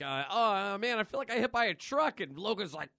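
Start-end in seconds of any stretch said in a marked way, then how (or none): 0.3-2.2 higher, louder, sore and complaining; groaning Deadpool. Retold or imitated. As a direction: moan like you got run over. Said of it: "it's just like".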